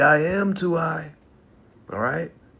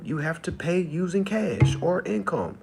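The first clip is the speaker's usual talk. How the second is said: usual speaking voice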